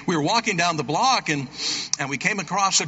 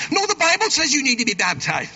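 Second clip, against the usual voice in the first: eager correction; 11 semitones higher than usual. Retold or imitated. Mary corrects the neighbor.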